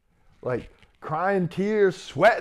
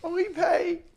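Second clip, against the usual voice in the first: mocking realization; 9 semitones higher, 5 dB louder than usual. Speaker reveals the truth mockingly.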